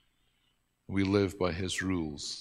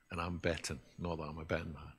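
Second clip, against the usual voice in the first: ordinary talk, nothing marked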